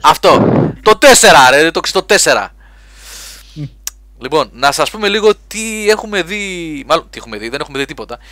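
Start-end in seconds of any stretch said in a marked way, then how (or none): 0.0-2.5 loud, higher call across the studio; raised voice calling out the track number. Real, not acted. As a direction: shout the request across the room. Calling out to the producer.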